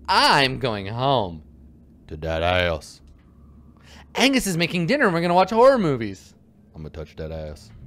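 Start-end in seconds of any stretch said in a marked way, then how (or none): 2.1-3.0 silly low voice. Acted. 6.8-7.7 silly low voice. Acted.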